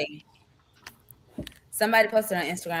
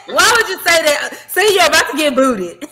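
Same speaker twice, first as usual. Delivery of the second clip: loud, high-pitched scolding between laughs; amused loud protest; 8 semitones higher, 9 dB louder than usual. Real, not acted. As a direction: call them out loudly, amused. Laughing protest at a co-host's remark.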